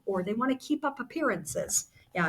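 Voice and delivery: ordinary delivery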